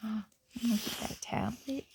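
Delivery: ordinary delivery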